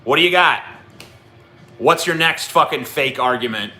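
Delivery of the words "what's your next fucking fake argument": ordinary talk, nothing marked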